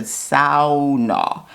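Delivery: "Sauna" said Finnish style, SOW-nah with the ow sound; Finnish pronunciation. Retold or imitated. Mother-in-law teaching the word, careful Finnish "Sauna". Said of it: "Repeat after me".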